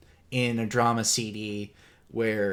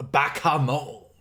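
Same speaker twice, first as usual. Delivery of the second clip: anime old man impression; 4 semitones higher, 4 dB louder, slower than usual. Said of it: "I do a very shitty, uh, impression".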